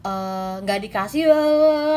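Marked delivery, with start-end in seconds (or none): 1.3-1.9 loud, high, drawn-out mock wail; imitated baby wail. Preacher mimicking a baby denied what it wants, mock wailing. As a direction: mimic a baby bursting into crying. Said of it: "langsung nangis gitu kan".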